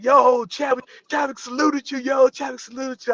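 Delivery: high, hyped-up friends voice; excited buddies. Retold or imitated. Fellow extras hyping him after Chadwick saluted, excited.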